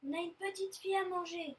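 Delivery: high, excited shout to mum; exclaiming. A narrator's reading. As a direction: announce the catch triumphantly. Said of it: "s'écrie Loustic".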